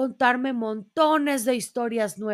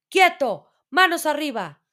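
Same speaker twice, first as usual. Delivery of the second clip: loud, higher command; police shout; 5 semitones higher, 6 dB louder than usual. A narrator's reading. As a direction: bark the order at the bear. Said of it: "se oyó un grito". (orden gritada con fuerza)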